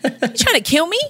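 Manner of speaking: high-pitched, jokey outrage; mock indignation. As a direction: retort in comic disbelief. Retold indignant reply to a recommendation.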